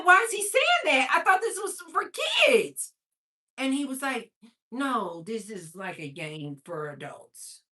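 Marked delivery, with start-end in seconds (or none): 0.0-2.9 high-pitched, indignant retold question; shocked questioning. Mother asking son about the game, shocked questioning. 4.7-7.6 put-on softer, lower, slowing little-kid explanation; Gary. Son explaining the game to his mother, lightly imitated. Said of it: "this is how Gary's talking".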